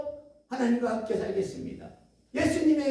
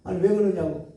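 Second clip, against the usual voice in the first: ordinary talk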